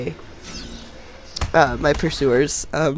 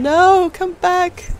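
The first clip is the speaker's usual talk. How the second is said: excited shout; 12 semitones higher, 4 dB louder, much slower than usual. Real, not acted. Targets fleeing in game.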